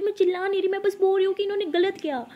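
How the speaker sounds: high, loud insistence that she isn't shouting; loud and high, defensive; yelling denial. Acted. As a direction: shout while denying you shout. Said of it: "मेरी मम्मा पे चिल्ला क्यों रही हो".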